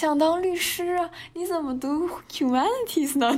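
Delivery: teasing imitation of a nosy officer; the customs officer. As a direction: ham up a puzzled officer who can't believe it, laughing through it. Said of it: "他说".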